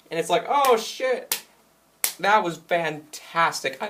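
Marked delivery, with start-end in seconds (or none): 0.5-1.4 high-pitched acted shock; shocked reaction. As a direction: react like a stunned onlooker, pitch leaping. Player's reaction to the finger break.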